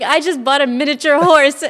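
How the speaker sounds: high, loud proud announcement; gleeful loud confession; excited. Retold or imitated. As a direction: blurt out the big news. Announcing horse purchase to husband.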